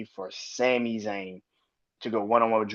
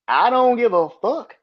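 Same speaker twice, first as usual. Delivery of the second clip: loud, higher defiant outburst; defiant shout; 10 semitones higher, 7 dB louder than usual. Real, not acted. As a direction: throw it out like you mean it. Ranting about wrestling booking.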